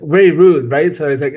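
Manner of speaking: usual speaking voice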